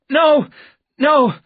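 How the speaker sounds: very high, loud frightened cries; high-pitched, forceful refusal; panicked cry. A narrator's reading. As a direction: cry out in terror. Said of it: "he cried".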